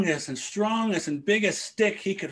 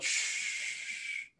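unvoiced hush; 11 dB quieter than usual. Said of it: "storyteller hushes listeners".

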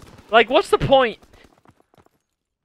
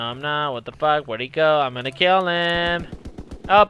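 ordinary delivery

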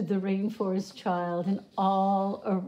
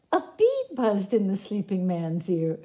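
plain reading voice

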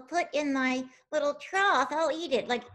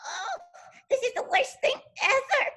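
high, half-sobbing puppet lament, much higher; hammed-up crying over a lost friend; upset wailing; 8 semitones higher, 4 dB louder than usual. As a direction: wail that it's the worst thing ever. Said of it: "scared and upset".

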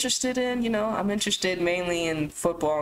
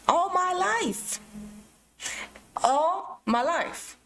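slow emphasis; 7 semitones higher, much slower than usual. Real, not acted. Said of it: "slow emphatic repetition".

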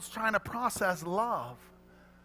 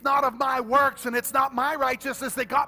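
higher, louder preaching with music; full-force preaching; 6 semitones higher, 8 dB louder than usual. Acted. Preacher proclaiming salvation to the congregation at full force.

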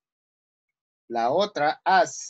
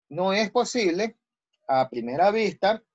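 ordinary talk, nothing marked

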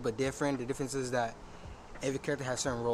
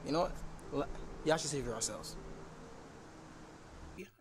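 normal talking voice

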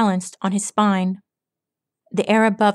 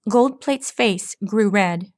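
ordinary delivery

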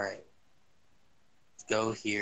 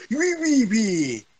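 high, silly mocking screech; mock screech at a teammate; mock ree noise; 7 semitones higher, slower than usual. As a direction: ree like a dumb meme.

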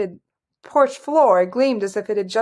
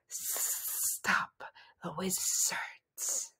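very slow, hissing snake whisper; soft whisper; 15 dB quieter, much slower than usual. A narrator's reading. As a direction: whisper it with long drawn-out hisses. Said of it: "whispered the soft voice".

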